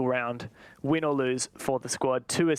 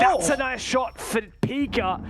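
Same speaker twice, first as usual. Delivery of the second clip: lifted, higher excited call of the shot; 6 semitones higher than usual. Real, not acted. Caster reacting live to a kill, excited and higher. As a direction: hype up the kill.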